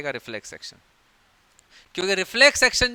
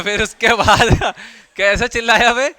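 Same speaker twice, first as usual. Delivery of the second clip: laughing mock retort; 4 semitones higher, 10 dB louder than usual. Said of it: "और लड़के".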